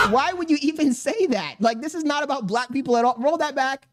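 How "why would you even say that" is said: much higher, shouted disbelief; incredulous yell. Real, not acted. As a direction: demand an answer, astonished. Reacting to racist clip.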